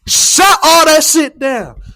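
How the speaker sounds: loud high drawn-out boast; loud slow boast. Real, not acted. Boasting the team shut them down, loud and slow. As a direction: stretch it out like a victory cry. Repeat of the phrase.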